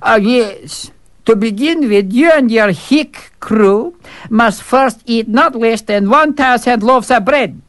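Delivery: high, formal announcement voice; pompous servant. Acted. Servant formally announcing the tests to the fool. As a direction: read out the decree with mock ceremony.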